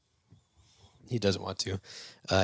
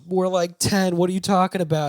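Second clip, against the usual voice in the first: high, louder imitation of alarmed kids; mock incredulous protest; 10 semitones higher, 5 dB louder than usual. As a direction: retell the kids' protest, pitched way up. Kids protesting to their teacher.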